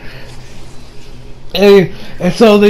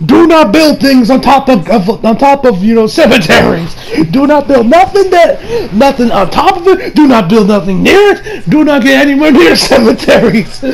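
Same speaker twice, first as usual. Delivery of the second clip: higher-pitched, exasperated comic plea; mock emphatic warning; 5 semitones higher than usual. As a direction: lay down the rule in mock alarm. Mock warning about building on cemeteries.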